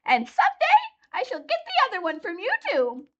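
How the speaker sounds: very high, sly witch voice; gloating witch. A narrator's reading. Witch threatening Dorothy, very high and gloating. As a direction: threaten with a sneer.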